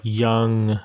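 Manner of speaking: ordinary talk, nothing marked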